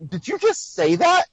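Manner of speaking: high-pitched, incredulous question; mock disbelief. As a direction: act appalled at what was just said, pitch jumping up. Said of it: "it kills me".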